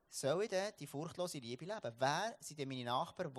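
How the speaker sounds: normal talking voice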